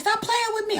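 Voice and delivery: high whiny mock ex; hammed-up whiny ex. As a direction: squeak it like a pouting boyfriend. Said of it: "He's like".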